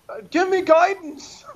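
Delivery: high, loud, slow mock cry for guidance; mock panicked plea. Friend mimics a desperate leader, high loud plea. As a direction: wail it like a man in over his head. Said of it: "and he's like".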